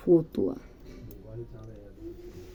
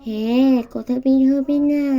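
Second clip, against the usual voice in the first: put-on child god voice; 6 semitones higher, 10 dB louder than usual. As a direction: speak as the baby god Gopal, pouting. The idol Gopal given a voice.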